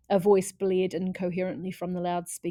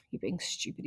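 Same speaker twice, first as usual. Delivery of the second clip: low, soft whisper; boy scolding himself in a whisper; 5 semitones lower, 10 dB quieter than usual. Acted. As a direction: whisper it under his breath. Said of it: "he said to himself".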